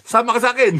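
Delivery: high, loud and insistent; urging the colonel to come up on stage; insistent invitation. Retold or imitated. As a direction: keep refusing and pull him along. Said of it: "Sabi ko".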